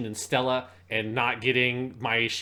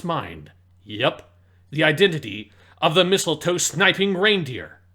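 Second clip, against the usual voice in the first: narrator voice; 3 semitones higher, 5 dB louder than usual. Pretend. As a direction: announce it like a narrator. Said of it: "read her announcement here in your narrator voice".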